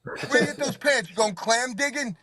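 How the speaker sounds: high-pitched teasing jabs; mocking family insults. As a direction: needle them like an insulting relative.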